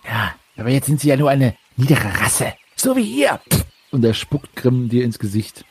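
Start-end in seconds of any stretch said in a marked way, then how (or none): 0.1-3.4 contemptuous sneer. Acted. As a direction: spit the insult. Cultist insults them.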